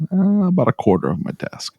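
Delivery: high, sheepish little answer; sheepish reply. Retold or imitated. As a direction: admit it with embarrassment.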